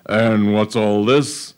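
slow, menacing question from a suspicious earl; a hint of a menacing earl catching his daughter; nasty lord. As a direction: drawl it out like a sly villain. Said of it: "His voice had a nasty tinkle".

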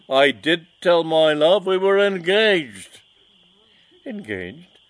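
loud, high, indignant villain; mustache twirling villain. Acted. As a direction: rant at the rival, outraged. Villain tells of broken engagement.

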